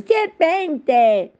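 a high, loud cry of alarm; shouting. A narrator's reading. Pigeon shrieks at long-necked Alice, loud high cry. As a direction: shriek the word at her like a startled bird.

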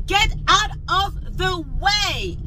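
each word spelled out slowly; slow emphatic demand. Real, not acted. Scolding people who walk straight at her, slow and emphatic. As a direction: drag it out so they finally get it. Ranting at pedestrians blocking her.

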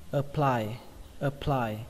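APPLY with a short "uh", weight on PLY; stress on PLY. Shown as a demonstration. Teacher modelling the word for learners, stress on PLY. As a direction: hit the second syllable of "apply", falling. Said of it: "trọng âm của nó rơi vào âm ply".

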